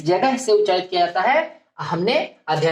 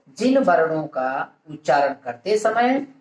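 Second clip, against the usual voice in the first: word by word with long pauses; dictation. Real, not acted. Teacher giving students a definition to write down, measured dictation. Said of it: "परिभाषा के रूप में लिख लिया जाए".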